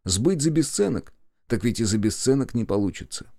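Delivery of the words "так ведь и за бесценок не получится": ordinary delivery, nothing marked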